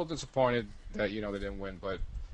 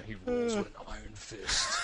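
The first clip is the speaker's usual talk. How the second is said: horror trailer voice. Acted. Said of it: "title for the ultimate B movie".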